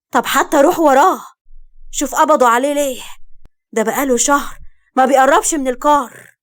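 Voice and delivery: high, loud, upset appeal; urgent pleading. A narrator's reading. Urging a father to follow his arrested son, pleading loudly.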